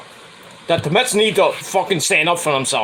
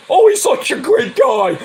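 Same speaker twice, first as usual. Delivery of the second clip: much higher, louder sarcastic fawning; mocking gush; 9 semitones higher, 4 dB louder than usual. Sneering at Yankee broadcasters praising a player, much higher and louder. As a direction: fawn sarcastically over a player.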